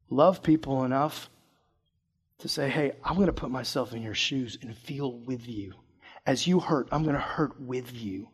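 soft, slow heartfelt plea; sincere. Real, not acted. Pastor urging teens to love their friends, soft and slow. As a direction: speak it gently as a real promise. Earnest plea to youth group.

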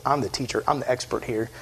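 low, soft, smug aside; self-important expert. Retold or imitated. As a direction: quietly pull rank, imitating a know-it-all. Smug expert challenging Jesus.

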